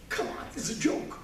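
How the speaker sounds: mock disbelief. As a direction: play at not believing you are a parent. Retells young parents' disbelief.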